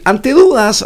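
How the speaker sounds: a sudden crack on the words. Real, not acted. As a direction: voice cracks up high. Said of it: "ay, me salió un gallo".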